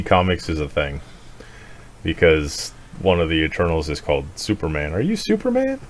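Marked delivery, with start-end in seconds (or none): 4.9-5.8 put-on kid voice. Kid asking in retold movie.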